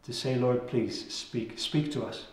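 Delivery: soft, earnest prayer voice. Real, not acted. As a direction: pray quietly and humbly to the Lord. Opening prayer before sermon.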